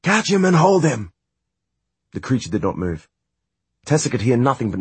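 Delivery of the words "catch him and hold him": high, loud shout; shouted command. A narrator's reading. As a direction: yell the order up. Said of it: "she shouted at the automaton".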